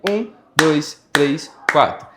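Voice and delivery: slow, evenly spaced count to four; counting beats. Shown as a demonstration. Music teacher showing pupils a four-beat bar, counting slowly and evenly. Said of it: "Vamos contar até quatro".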